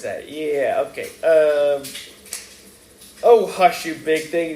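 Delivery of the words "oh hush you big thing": sharp, loud snap to hush someone; snapped. A narrator's reading. Young man snapping at a rude bar neighbour, sharp and loud. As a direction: bite off the words in irritation. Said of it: "the young man snapped".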